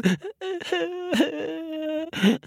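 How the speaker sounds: high wailing fake sob; hammed-up high crocodile sobbing; mock crying wail. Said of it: "He was crying crocodile tears".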